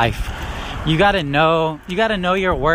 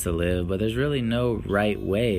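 subdued reflective; 7 semitones lower, 9 dB quieter than usual. Real, not acted. Reflective soft monologue.

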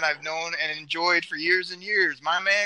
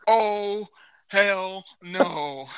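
usual speaking voice

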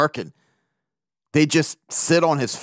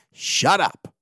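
soundbite shut up; 3 semitones higher than usual. Pretend. Dropped-in soundbite voice.

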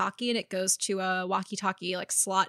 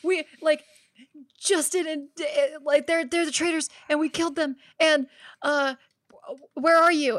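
higher, louder excited buddy voice; imitating a friend on walkie-talkie; 7 semitones higher, 7 dB louder than usual. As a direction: play the teammate crackling through the radio, thrilled. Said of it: "and he was like".

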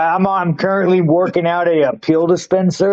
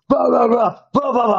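a higher mock rant of blahs; mock angry ranting; 6 semitones higher than usual. Mocking how a teammate lost his temper. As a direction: parody an angry outburst. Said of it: "He goes straight angry".

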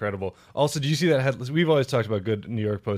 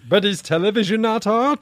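higher put-on voice, laughing; pompous higher character voice; 8 semitones higher than usual. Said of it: "I do a lot more voices".

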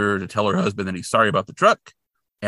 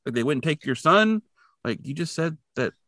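pitched-up, exasperated objection; incredulous rant; 6 semitones higher than usual. Real, not acted. Viewer arguing with the mother on screen, pitched-up disbelief. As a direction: jab at the plot's logic. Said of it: "And I'm like".

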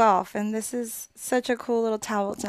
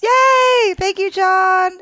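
excited cheer; 8 semitones higher, 9 dB louder, much slower than usual. Real, not acted. Cheering for a listener by name.

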